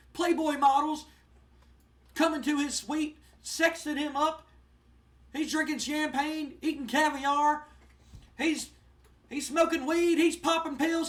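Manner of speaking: high, loud, boastful manager rant; hammed-up heel manager bragging and mocking; Jim Cornette ranting. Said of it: "and Jim Cornette goes".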